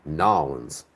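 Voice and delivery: Nolans said as one clipped word, NAH-lunz; Southern Nolans, dropping the New; Southern pronunciation. Shown as a demonstration. Showing a Northerner's favorite Southern word, NAH-lunz. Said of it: "the Southern pronunciation of New Orleans is Nolans".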